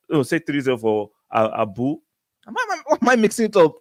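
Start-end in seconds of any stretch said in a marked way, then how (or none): 2.5-3.8 high, fast, exasperated at himself; flustered self-scolding. Real, not acted. Streamer mixing up viewers' names before coffee, flustered and quick. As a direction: tut at your own mix-up.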